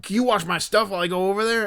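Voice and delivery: high, hurried dude voice; intense Philly dude. Retold or imitated. Fan asking a stranger to watch his stuff, hurried high dude. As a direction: ask a quick favor as the guy. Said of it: "the impression that I was doing of him".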